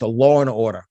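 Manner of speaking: law and order in a New York accent, lawr-and-awdah. Shown as a demonstration. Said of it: "That's my New York accent".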